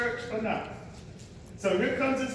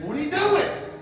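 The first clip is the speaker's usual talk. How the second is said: pastor's cue; 4 semitones higher, 4 dB louder than usual. Pretend. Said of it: "wait till the pastor says".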